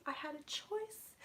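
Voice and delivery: slow breathy whisper of regret. Real, not acted. Psychic whispers the spirit's confession. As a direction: breathe it out slowly.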